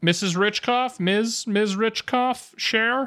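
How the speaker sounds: teasingly climbing up through her titles; mock formal address.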